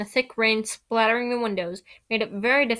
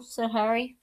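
usual speaking voice

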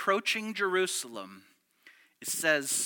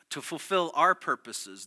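normal talking voice

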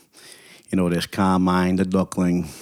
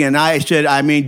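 ordinary talk, nothing marked

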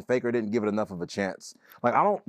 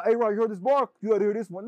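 mock eager friend; 7 semitones higher than usual. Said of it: "They go".

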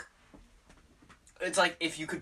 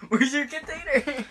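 urgent; 12 semitones higher, 5 dB louder than usual. Real, not acted. Needs to spit out the candy.

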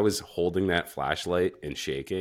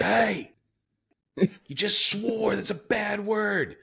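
put-on very high, drawn-out scolding child; indignant little kid; slower than usual. Four-year-old son catching dad swearing, indignant high voice. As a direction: tell on dad in a squeaky kid voice. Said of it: "he gets so pissed when I swear".

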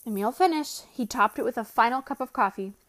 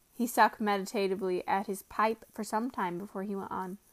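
usual speaking voice

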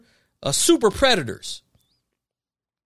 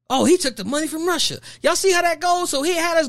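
ordinary delivery

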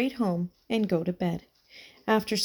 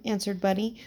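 plain reading voice

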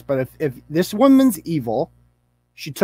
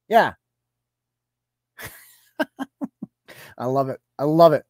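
normal talking voice

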